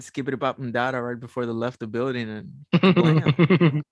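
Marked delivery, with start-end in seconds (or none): 2.9-3.8 loud, high blam like a blast; explosion sound. Retold or imitated. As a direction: shout it like a bomb going off. Explosion in the retold scene.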